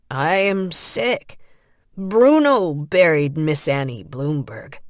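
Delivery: simple stubborn reply; Lawrence's voice. A narrator's reading. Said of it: "Lawrence kept repeating himself".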